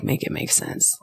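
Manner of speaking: breathy exasperated whisper. Retold or imitated. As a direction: whisper it in disbelief. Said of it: "I was like".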